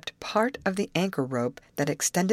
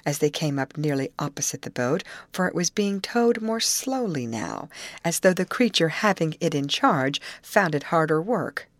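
ordinary delivery, nothing marked

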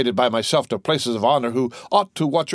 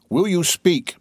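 higher, sharp royal demand; impatient demand; 7 semitones higher, slower than usual. A narrator's reading. King to his minister, impatient demand. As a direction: press him to speak at once.

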